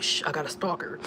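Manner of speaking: softly hushed confession, retold; hushed explanation. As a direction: explain it under your breath. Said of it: "He was like".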